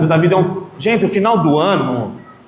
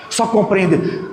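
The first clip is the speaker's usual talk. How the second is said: ordinary talk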